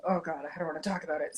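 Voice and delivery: ordinary talk, nothing marked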